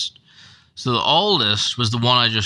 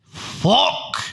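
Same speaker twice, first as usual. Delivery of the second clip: sudden higher breathy mock curse; panicked curse; 11 semitones higher than usual. Kirky panicking in bed, sharp curse. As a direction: blurt it out in panic. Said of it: "he actually says the word fuck".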